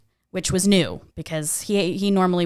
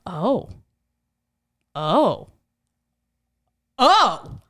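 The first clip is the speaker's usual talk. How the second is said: rising anger; much slower than usual. Retold or imitated. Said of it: "I was starting to get worked up".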